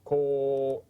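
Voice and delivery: "Ko" with the o held long; long vowel. Shown as a demonstration. Teacher confirming what the hiragana makes, long "Ko". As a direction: stretch "Ko" out on one steady o. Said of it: "Which makes?".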